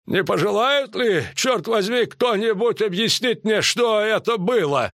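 high-pitched, indignant character voice; indignant marshal. Acted. Маршал возмущён после погрома. (возмущённый маршал, высоким голосом)

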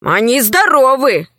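plain reading voice

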